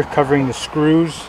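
usual speaking voice

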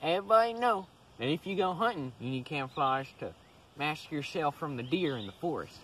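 normal talking voice

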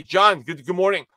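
normal talking voice

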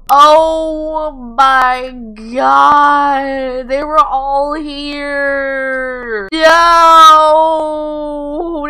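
high, hugely drawn-out cry; astonished wail. Real, not acted. Player finding they were all here, astonished wail. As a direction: stretch every word in loud amazement. Finds everyone outside her door.

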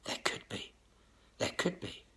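breathy whisper, fading doubt; mimicking gullible people whispering "there could be"; whispered musing. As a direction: whisper it to yourself as if half-convinced. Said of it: "Most people were sitting there thinking".

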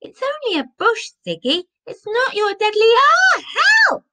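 very high pleading rising to a yelp; protest, then scream for help; panicked protest. A narrator's reading. Mister Ellis attacked, calls for help.

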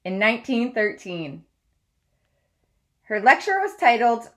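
steady read-aloud storytelling voice; story narration. Acted. Teacher reads biography aloud.

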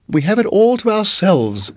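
a hint of a doting hostess; sweet landlady. A narrator's reading. Landlady voiced by narrator.

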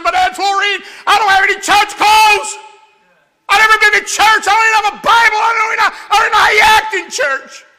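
high, loud, flustered pleading; panicked excuses. Retold or imitated. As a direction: blurt excuses in a rising panic.

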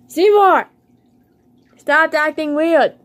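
high loud call of the fish's name. Real, not acted. Owner calling his fish Seymour, loud call. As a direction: call him sharply across the tank.

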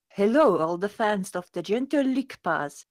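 hammed-up French accent. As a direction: read it out in a put-on French accent. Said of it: "excellent French accent".

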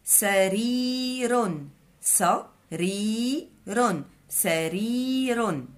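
سرير drawn out slowly, syllable by syllable; slow syllable-split reading of the word. Shown as a demonstration. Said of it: "عندنا المثال اللي عطينا".

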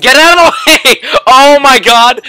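yelling. Real, not acted. Blocked while fleeing.

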